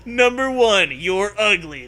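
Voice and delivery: hammed-up loud dog announcer. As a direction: read the list like a game-show host. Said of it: "the dog begins".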